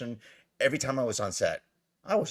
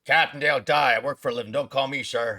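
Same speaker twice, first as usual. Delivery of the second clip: louder, emphatic officer voice; gruff captain's correction; 7 dB louder than usual. Retold or imitated. Captain rebuking the actor who called him sir, lightly imitated. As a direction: set him straight with military authority.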